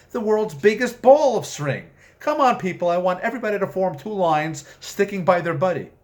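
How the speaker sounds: high, loud cheerful tour-guide push; rally the group like a camp counselor; peppy demon guide. Acted. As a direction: demon herding the damned off the bus, loud and chipper. Demon enthuses, herds the damned.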